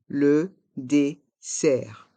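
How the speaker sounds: "Le dessert" in slow, separate syllables; slow model pronunciation. Shown as a demonstration. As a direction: pause after each syllable of "Le dessert". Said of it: "Prononçons".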